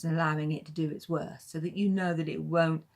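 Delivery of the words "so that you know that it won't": normal talking voice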